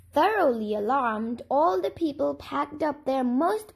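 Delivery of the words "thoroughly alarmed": ordinary delivery, nothing marked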